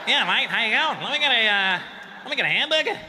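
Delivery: broad, hammed-up Australian accent; ordering a burger like a cartoon Australian; Australian impression. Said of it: "That's my impression of you guys".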